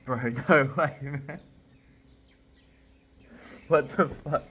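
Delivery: loud, high stunned disbelief; real shock. Real, not acted. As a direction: reel in disbelief with a stunned laugh. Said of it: "I'm actually in shock".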